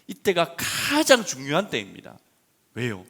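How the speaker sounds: loud, high fervent preaching; preaching at full force. Acted. Pastor proclaiming grace to the congregation, fervent loud preaching. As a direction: proclaim it to the whole church.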